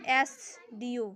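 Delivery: एस डी ओ said slowly, starting with a clear ए; sounding out SDO. Shown as a demonstration. Teacher showing how एस डी ओ is said. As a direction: draw out एस डी ओ. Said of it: "उच्चारण भी देखिए".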